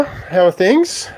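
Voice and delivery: ordinary talk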